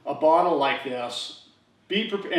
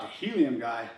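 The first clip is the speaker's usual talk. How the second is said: softer, lower, to himself; "The helium guy?" as a quiet thought in his head; inner thought; 7 semitones lower, 7 dB quieter than usual. Retold or imitated. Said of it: "in the back of my head, I'm thinking this".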